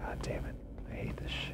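tense frightened whisper. Real, not acted. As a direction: breathe the curse under breath. Tense in horror maze.

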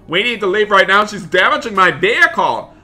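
mild acted panic; alarmed calling out. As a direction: urge everyone out in alarm. Fleeing as vehicle is damaged.

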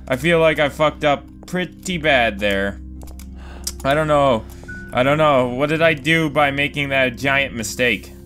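ordinary talk, nothing marked

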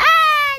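loud high laughing squeal; a real yelp that breaks into a laugh; startled squeal. Real, not acted. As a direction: squeal as the pony takes it. Pony grabs the grass.

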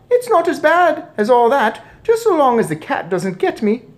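very high, loud squeak; mouse boy. A narrator's reading. Mouse boy tells father it's fine, squeaky. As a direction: chirp it unconcerned.

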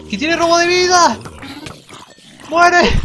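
very high, loud alarmed yell; panicked shout. Real, not acted. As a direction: scream it in alarm.

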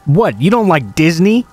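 a hint of a stranger suddenly yelling; retold shout. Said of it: "He started to shout".

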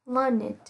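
"learned" in two syllables, LUR-nid; careful teacher's "learned", ending in -id. Shown as a demonstration. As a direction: give the ED of "learned" its own short syllable. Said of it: "sẽ được phát âm là E D".

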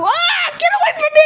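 high frantic scream to get away; panicked scream. Acted. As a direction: shriek it out in panic. Puppet attacked, screaming.

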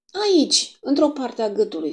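put-on a high, proud little boy showing off; self-important. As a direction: announce it as if it is big news. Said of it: "spuse Ronald cu importanță".

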